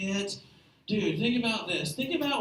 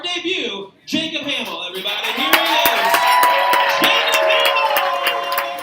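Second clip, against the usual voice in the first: drawn-out, very loud, high name call; announcer intro; 7 semitones higher, 12 dB louder, slower than usual. Acted. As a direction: belt the comedian's name to the room. Host introduces comedian.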